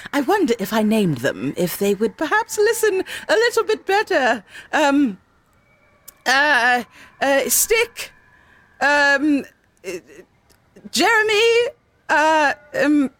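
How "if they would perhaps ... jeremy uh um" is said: flustered, pitch climbing high; straining to think up names; strained high. Real, not acted. Struggling to name the creatures.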